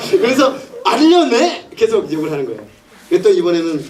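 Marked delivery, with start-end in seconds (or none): 0.8-1.7 high mock tantrum; petulant demand. Woman nagging Samson for his secret, high petulant demand. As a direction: demand it like a pestering lover. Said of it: "앙탈을 부리기 시작하죠".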